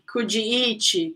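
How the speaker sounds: slow "coodjee eechee" for "could you eat"; wrong pronunciation. Shown as a demonstration. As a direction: add "ee" after "could" and "eat". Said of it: "It's not".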